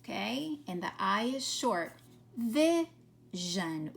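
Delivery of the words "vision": "Vision" split in two with a pause between; sounded out in parts. Shown as a demonstration. Teacher showing pupils the zhun sound, word sounded out in parts. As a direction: sound out "Vision", holding the first part apart. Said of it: "notice this one's making that zhun sound".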